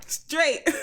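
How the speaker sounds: excited. Real, not acted. Joking emphasis.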